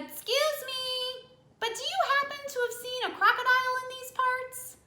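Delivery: put-on a tiny high polite child asking; polite elephant child. Elephant child politely asks a stranger, in a high little voice.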